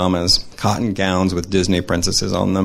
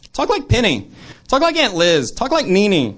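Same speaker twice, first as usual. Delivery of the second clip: put-on much higher, eager child's requests; little girl voice; 10 semitones higher than usual. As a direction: play a little girl firing off demands. Nieces pestering for impressions.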